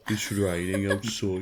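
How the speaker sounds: slow mock Birmingham accent; Brummy accent. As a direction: draw it out slowly in imitation Brummy. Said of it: "That's what they sound like".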